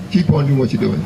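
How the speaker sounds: usual speaking voice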